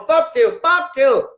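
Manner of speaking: high, loud parrot squawk. Retold or imitated. All the parrots swear at the visitors, high squawk. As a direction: squawk it like the whole flock of birds. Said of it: "So they're all going".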